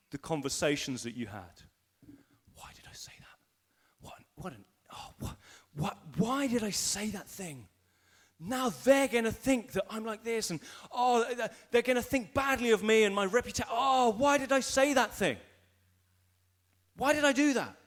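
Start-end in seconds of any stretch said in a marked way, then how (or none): 2.5-7.7 halting, hushed start that grows into full voice; regretful self-questioning. Acted. Person alone at night replaying conversations, hushed regret building. 8.4-17.7 higher, louder acted fretting; anguished self-reproach. Person replaying a conversation at night, acted anguish.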